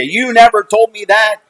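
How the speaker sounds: high, indignant outburst as mother; imitated mother in a fight; retold accusation. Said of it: "we'd have this huge fight".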